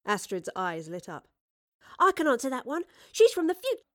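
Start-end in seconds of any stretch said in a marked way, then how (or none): 2.0-3.9 put-on high, eager girl's blurting; excited young girl. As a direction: pipe up loud and high, bursting to tell.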